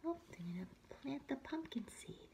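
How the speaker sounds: quick excited whisper. Real, not acted. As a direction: lean in and whisper it. Aside to listening child.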